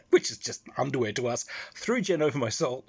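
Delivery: ordinary talk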